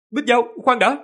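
high, loud cry to stop; urgent call. A narrator's reading. Trương Tiểu Phàm tries to stop Bích Giao's attack, urgent shout. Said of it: "Trương Tiểu Phàm nói gấp".

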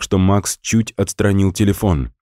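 usual speaking voice

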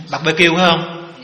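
laughing through the joke; a teasing question breaking into laughter; chuckling mock question. Joking Brahmin asks about barbecue.

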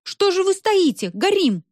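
very high, loud and urgent; alarmed exclaiming. A narrator's reading. Woman urging a man to act in a fire, loud high alarm. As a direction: exclaim to jolt someone into action. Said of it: "воскликнула Роза Жановна".

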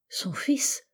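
whispered question rising high; stunned whisper. A narrator's reading. Narrator echoing her words in disbelief, whispered. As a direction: breathe it out in disbelief.

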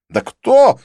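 loud, high, bewildered question; exasperated high-pitched puzzlement; baffled. A narrator's reading. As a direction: demand to know, voice shooting up. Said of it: "недоумевал Дидье".

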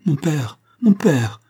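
high, loud appeal, rising on the repeat; crying out. A narrator's reading. Daughter suddenly calling out to her father, impassioned cry. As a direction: cry out to him urgently. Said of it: "s'écria-t-elle avec un changement soudain".

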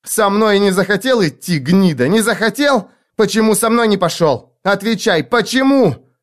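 put-on high, raging girl's voice; furious interrogation. A narrator's reading. Girl blaming the man for going without her, furious interrogation. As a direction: hammer the questions high, climbing to the last.

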